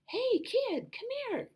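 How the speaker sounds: very high, friendly falsetto call; squeaky high Elmo-ish kid voice. Shown as a demonstration. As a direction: pitch way up like talking to a toddler. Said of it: "the higher voice is friendly".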